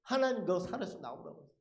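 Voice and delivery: normal talking voice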